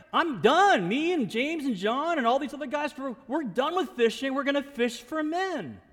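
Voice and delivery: high, swooping announcement of quitting; emphatic declaration. Acted. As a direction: burst out loudly, then keep it high. Peter declaring he quits fishing.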